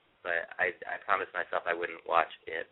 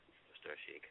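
fading whisper; 4 semitones lower, 21 dB quieter than usual. Real, not acted. Repeats phrase, fading.

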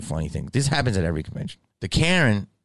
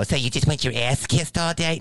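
higher sneering put-on voice of a clueless critic; mocking an idiot; 6 semitones higher than usual. Mimicking people who misunderstand him, sneering. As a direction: parrot the dumb question back with scorn. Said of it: "idiots that don't understand will go".

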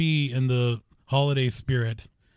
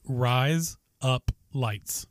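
slow demo; much slower than usual. Shown as a demonstration. Father slowly gives his son the words to repeat. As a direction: rise up lights said very slowly and evenly. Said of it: "You're going to say".

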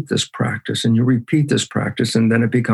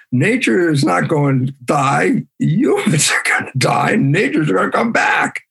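emphatic build; 5 semitones higher than usual. Real, not acted. Lecturer warning humans about nature, emphatic rising delivery. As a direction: raise the pitch phrase by phrase to a climax.

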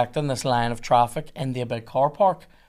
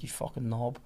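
very soft retold curse; muttered insult; 2 semitones lower, 11 dB quieter than usual. Driver at cop photographing his number plate, muttered curse. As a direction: swear under your breath.

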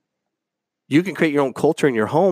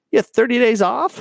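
high, loud questioning rise on days; incredulous question; 12 semitones higher, 4 dB louder than usual. Real, not acted. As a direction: scoff at the idea of a month off. Mocking the ad's perks.

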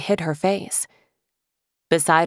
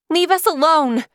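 much higher, louder defiant cry; yelling; 11 semitones higher, 4 dB louder than usual. Acted. Lily shouting at the dragon, high desperate yell. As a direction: hurl the demand at the monster. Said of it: "she yelled at the monster".

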